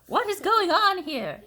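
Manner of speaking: high, loud, slow demand; bossy demand. A narrator's reading. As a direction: demand an answer sternly. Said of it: "asked Eugenia".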